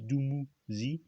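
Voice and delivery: "Dumuzi" spelled out slowly, "doo-moo-zee"; slow, deliberate "Dumuzi"; careful pronunciation. Shown as a demonstration. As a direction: sound out "Dumuzi" syllable by syllable, slowly. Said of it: "parler de Dumuzi".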